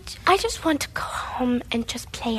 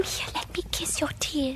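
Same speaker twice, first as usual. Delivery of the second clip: whisper; 10 dB quieter than usual. Acted. Sibling comforting crying brother.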